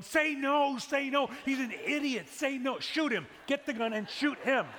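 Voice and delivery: loud high mock yell at the screen. Pastor yelling at a TV proposal, loud. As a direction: shout it at the television, half joking. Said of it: "I was too busy yelling".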